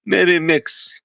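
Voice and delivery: put-on Russian accent, pitch climbing toward the end. Guest tries a Russian accent on western friends. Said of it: "my Russian accent".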